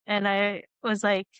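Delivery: normal talking voice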